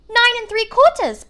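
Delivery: put-on high, piping small-girl voice; a small girl pipes up, eager; piped little girl. A narrator's reading. Small girl pipes up to her mother, high and piping. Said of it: "piped a small girl".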